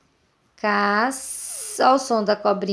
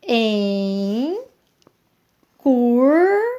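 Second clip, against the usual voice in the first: "Em" and "curta" split by a clear pause, "curta" louder; syllables split; 4 dB louder, much slower than usual. Shown as a demonstration. Teacher splitting "Em curta" into syllables for pupils, clear pause between. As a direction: pause after "Em", then land hard on "curta". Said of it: "eu separo assim".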